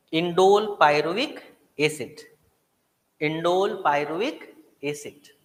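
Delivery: Indole pyruvic acid said as a slow drill, indole stressed; repeat after me. Shown as a demonstration. Teacher drilling students to repeat the name, slow and stressed. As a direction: chant it slowly so the class can repeat, leaning on indole. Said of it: "याद कर लो मेरे साथ".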